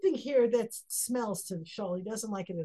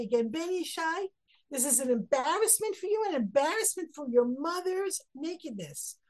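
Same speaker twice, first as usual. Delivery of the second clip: raised, heated rebuke of a son; angry king; 6 semitones higher than usual. Retold or imitated. King Shaul berating his son Yonatan, heated and raised. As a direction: rail at the son as a furious king. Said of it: "Shaul gets very angry with".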